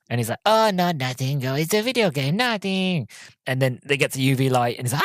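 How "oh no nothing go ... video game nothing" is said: high-pitched, hammed-up cover-up; mock panicked denial. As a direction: play a vampire caught out, voice pushed high. Vampire's panicked denial, mimicked.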